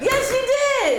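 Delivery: high, drawn-out protest; insistent contradiction. Real, not acted. Friend disputing who said it, high indignant insistence.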